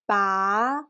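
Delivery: "ba" gliding upward to the end; second-tone "ba", pitch climbing; rising tone. Shown as a demonstration. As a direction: say "ba" like a question. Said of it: "pronounce like a rising tone".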